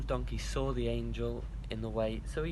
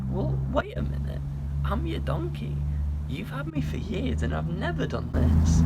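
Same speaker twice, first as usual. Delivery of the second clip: squeaky, much higher voice for the donkey; talking donkey; 9 semitones higher, 4 dB louder than usual. Acted. Donkey protesting to his master, high and squeaky. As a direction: lift into a surprised, pleading donkey voice. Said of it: "And the donkey replied".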